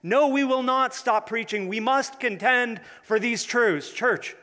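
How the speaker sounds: loud, fervent rally cry; rally the crowd, defiant; preaching at full force. Acted.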